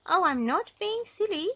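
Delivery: high, loud goblin retort. A narrator's reading. Goblin contradicting Mister Uppity, in a high voice. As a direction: answer back in a high little goblin voice. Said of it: "said the goblin".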